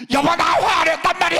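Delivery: high, loud mock yell at the spouse; angry shout. Pastor mimics a husband yelling at his wife, loud mock shout. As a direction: blow up at your spouse. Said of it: "이렇게 화내지 말고".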